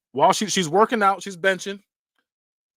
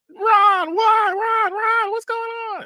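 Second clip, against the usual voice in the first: very high hysterical calling, hammed-up; shrieked imitation of alarmed parents; mock frantic parents; 4 dB louder, slower than usual. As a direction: wail the name in exaggerated panic. Parents calling through a closed door.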